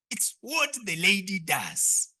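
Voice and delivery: ordinary talk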